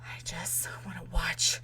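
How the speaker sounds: slow, furious whisper; whispered rage, slow and deliberate; angry whisper. Retold or imitated. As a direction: hiss it through frustration. Frustrated hiss at cinema.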